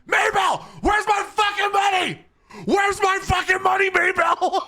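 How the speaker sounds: high, loud screamed demand; furious shout. Retold or imitated. Roommate screaming at Maybell for his money. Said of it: "he walks up and says".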